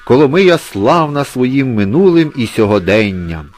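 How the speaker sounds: proud exclaiming cat. A narrator's reading. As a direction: boast grandly as the town's famous cat. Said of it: "вигукнув Гудзик". (гучна урочиста промова хвалькуватого кота)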